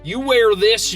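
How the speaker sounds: ordinary talk, nothing marked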